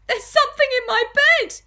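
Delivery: high, shrieking alarm all the way through; panicked scream. Acted. Wife bouncing about in bed, panicked scream. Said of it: "screamed Missus Twit".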